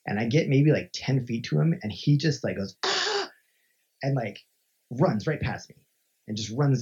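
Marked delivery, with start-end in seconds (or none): 2.8-3.3 sudden high-pitched yelp "Ah!"; short mock scream; retold scream. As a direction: imitate a startling shriek. Stranger lunges and screams.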